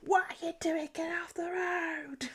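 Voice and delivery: a hammed-up angry driver, whispered; mock road rage. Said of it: "Like I do in the car".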